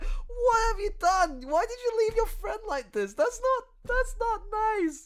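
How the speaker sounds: wag a finger like a prissy schoolteacher; mock scolding. Retold reaction reading the comic.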